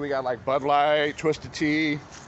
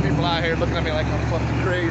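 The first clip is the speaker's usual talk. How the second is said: ordinary talk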